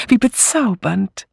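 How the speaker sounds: high, slow, fluty gush; cooing admiration, pitched high; fluting. A narrator's reading. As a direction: draw it out sweetly up high. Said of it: "flötete Misses Easterbrook".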